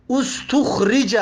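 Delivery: استخرج said as us-tukh-ri-ja; passive vowels. Shown as a demonstration. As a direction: sound out استخرج as "ustukhrija".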